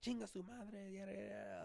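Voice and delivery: high, mocking cussing voice; a lightly hammed-up angry customer; mock angry Mexican guy. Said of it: "he's like".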